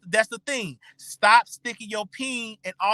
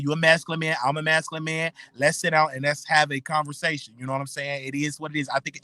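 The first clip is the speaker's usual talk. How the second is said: ordinary talk, nothing marked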